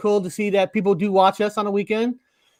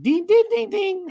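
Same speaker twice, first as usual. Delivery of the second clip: high-pitched ringing dings; light, high "ding" sound effect; imitated phone sound; 10 semitones higher, 4 dB quieter than usual. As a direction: mimic a phone chime up high. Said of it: "I like your phone sound".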